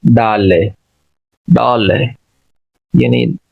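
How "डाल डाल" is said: डाल with open, unrounded AA; North American pronunciation. Shown as a demonstration. Teacher shows how Americans read dollar, open unrounded vowel. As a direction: open the first vowel wide, as in father. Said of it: "American इसको पढ़ते हैं".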